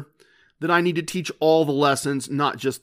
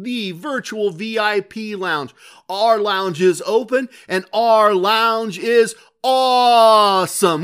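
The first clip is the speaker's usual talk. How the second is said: higher-pitched showman welcome, big stretched words; announcer; 5 semitones higher than usual. Acted. Host welcoming listeners to the virtual VIP lounge, hyped announcer. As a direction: sell it like a hammy lounge emcee.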